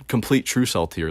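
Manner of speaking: ordinary delivery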